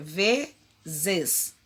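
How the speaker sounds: "vezes" stretched very slowly to show the Z; slow emphasis. Shown as a demonstration. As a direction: draw out "vezes" so they hear the zes. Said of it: "está em que posição? Olha".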